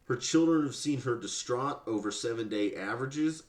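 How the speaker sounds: ordinary delivery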